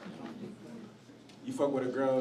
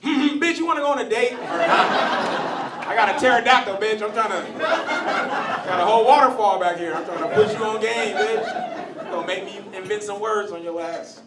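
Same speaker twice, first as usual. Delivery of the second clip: loud, high, pushy hollering; hammed-up frustrated come-on at full volume; impatient caveman suitor; 7 semitones higher, 10 dB louder than usual. Caveman asking for a date, loud impatient badgering. Caveman courting, grunted into words.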